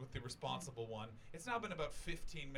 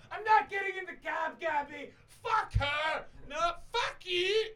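put-on shrill drunken yelling, much higher and louder; drunk screaming row; 11 dB louder, slower than usual. Drunk Scottish girls screaming at each other by the cab.

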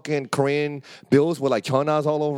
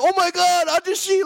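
high pleading oh my God; flustered plea to the old man; mock startled panic; 4 dB louder than usual. Friend grabbed by old man, high frightened plea. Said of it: "He was like".

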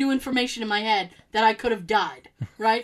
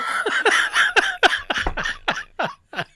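big laugh; 10 semitones higher, 4 dB louder than usual. Real, not acted. Laughing at coworker's joke.

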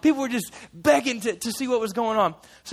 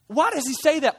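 higher and louder, an offended scribe; indignant Pharisee; 6 semitones higher, 5 dB louder than usual. Acted. Pharisees object to Jesus forgiving sins, indignant. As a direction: scoff at him, offended. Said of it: "the Pharisees are like".

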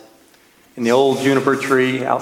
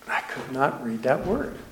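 much softer, under the breath; hushed confession; 10 dB quieter than usual. Real, not acted. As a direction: admit it quietly, as an aside. Confesses aside after stalling on scripture.

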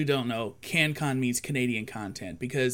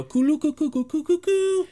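high, loud cooing call; imitated intro call; 13 semitones higher, 5 dB louder than usual. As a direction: coo it out like the show's opening. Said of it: "Dave Thomas would go".

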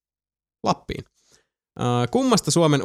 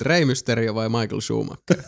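ordinary talk

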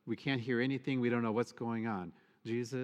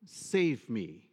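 crying out for help; 6 semitones higher, 4 dB louder, much slower than usual. Retold or imitated. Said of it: "He cried out also".

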